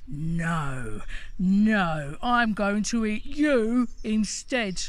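loud troll voice that booms; troll roaring at the middle goat, loud. Acted. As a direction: bellow it like a hungry troll. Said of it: "the troll roared".

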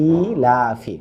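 ইলাফি very slow, with both the ই and the লা drawn long; recitation demo. Shown as a demonstration. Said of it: "টেনেই বলতে হবে এইভাবে".

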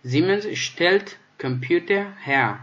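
slow, deliberate German; slow model reading. Shown as a demonstration. Teacher to students, slow and deliberate example sentence. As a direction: lay each word down slowly. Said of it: "teacher reads example sentence".

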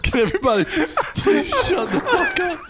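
laughing. Real, not acted. Roasted, pleading while laughing.

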